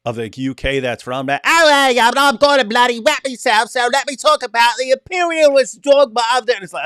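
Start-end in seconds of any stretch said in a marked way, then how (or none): 1.5-6.5 put-on high, loud caricature of a UK accent; mock British accent. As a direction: rant in a ridiculous, exaggerated British accent. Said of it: "the king of stupid sounding accents".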